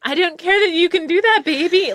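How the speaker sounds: high, loud mocking voice; mock the person showing off. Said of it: "And you're like".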